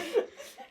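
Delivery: very high squeak of a laugh; giggling at the memory; very high giggle. Real, not acted.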